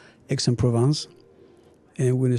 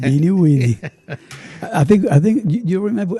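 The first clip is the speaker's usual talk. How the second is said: chuckling through the words, delighted; laughing; 6 semitones higher, 5 dB louder than usual. Real, not acted. Chef laughing as he recalls the Beanie Weenies joke. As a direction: laugh as the memory comes back.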